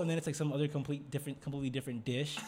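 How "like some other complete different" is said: ordinary delivery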